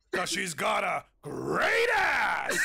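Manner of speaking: building to a drawn-out shout; Nicolas Cage-like escalation. Acted. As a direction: stretch it out into a huge yell. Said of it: "getting louder".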